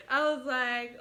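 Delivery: bursting into high laughter mid-story; laughing. Real, not acted. Laughing while retelling shock.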